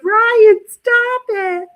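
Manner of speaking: high, loud squealing protest; mock mother-in-law. Embarrassed mom squeals at teasing Ryan, high mock protest. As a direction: shriek it like a flustered mom.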